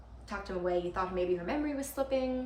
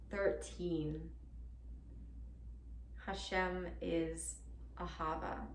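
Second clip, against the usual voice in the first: hushed reading aloud; 5 dB quieter than usual. Acted. Girlfriend reading out what Ahava means, hushed and measured. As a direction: read it softly, letting each phrase settle.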